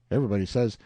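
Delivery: ordinary delivery, nothing marked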